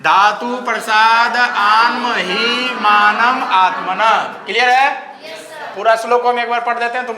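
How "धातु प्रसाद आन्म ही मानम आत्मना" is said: धातु प्रसाद आन्म ही मानम आत्मना in one slow unbroken breath; धातु प्रसाद आन्म ही मानम आत्मना को एक साँस में धीरे-धीरे बोलना; slow recitation. Shown as a demonstration. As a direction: recite the whole phrase slowly in one go. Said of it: "एक बार में इसको ऐसे बोलना".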